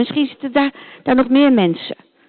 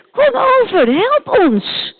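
high, louder calling out; retold call; 11 semitones higher, 3 dB louder, slower than usual. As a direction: echo the man's cry for help. Paulus retells the call. (hoog en luid nagedaan roepen)

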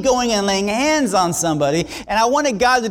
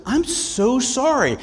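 lady apologising; 6 semitones higher, slower than usual. Retold or imitated. Lady apologises after collision.

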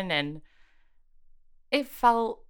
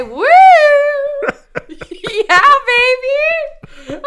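screaming high whoop; hype cheer; 12 dB louder, slower than usual. Acted. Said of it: "you just gotta really hype it up".

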